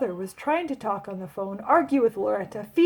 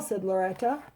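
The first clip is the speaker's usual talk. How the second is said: ordinary delivery, nothing marked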